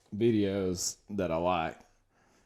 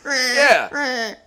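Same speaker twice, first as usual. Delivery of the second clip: high, loud, cry-laughing; laughing so hard; 11 semitones higher, 9 dB louder, much slower than usual. Real, not acted. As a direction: voice breaking with laughter.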